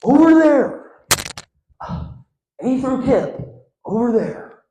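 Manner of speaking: high-pitched exasperated order; getting mad. Acted. Supervisor directing confused students, mad and exasperated. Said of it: "and he's getting mad".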